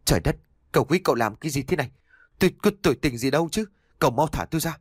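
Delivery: lắp bắp sợ hãi. A narrator's reading. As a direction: stumble over the words, terrified. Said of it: "anh ta lắp bắp". (giọng lắp bắp hoảng sợ)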